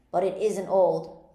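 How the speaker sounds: loud, slow, emphatic anger; furious. A narrator's reading. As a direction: cry it out loudly, stretching the words in anger. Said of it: "in a greater fury than ever".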